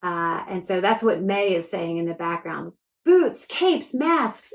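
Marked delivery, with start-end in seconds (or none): 3.1-4.5 excited listing. Acted. May delighted by new costume.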